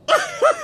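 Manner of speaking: high laugh. Real, not acted. Laughing at own rant.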